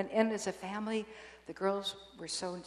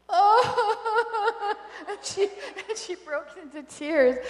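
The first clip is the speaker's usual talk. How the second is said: laughing exclamation; 12 semitones higher, 11 dB louder, much slower than usual. Real, not acted. Laughing at the retold surprise.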